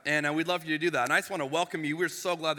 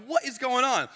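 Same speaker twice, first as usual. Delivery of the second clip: shocked disbelief; 6 semitones higher than usual. Retold or imitated. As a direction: blurt it out in amazement. Stunned by cash purchase.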